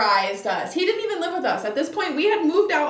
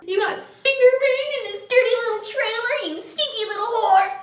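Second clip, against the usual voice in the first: high, louder mocking voice of the shaming boyfriend; mocking angry boyfriend; 12 semitones higher, 5 dB louder than usual. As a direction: sneer it as the raging boyfriend.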